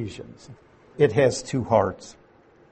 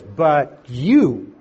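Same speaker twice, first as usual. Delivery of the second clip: YOU hit hard and louder; stress on you; 4 dB louder than usual. Shown as a demonstration. Teacher reading scripture to class, punching you. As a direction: lean on you to set believers apart. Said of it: "the you is very emphatic".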